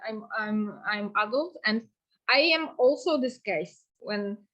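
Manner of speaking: normal talking voice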